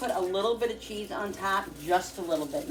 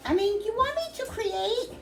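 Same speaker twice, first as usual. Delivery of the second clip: calling from far away. Real, not acted. Answering someone in another room.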